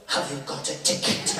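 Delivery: urgent hushed whisper. Retold or imitated. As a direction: demand it quietly.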